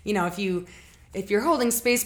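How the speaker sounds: normal talking voice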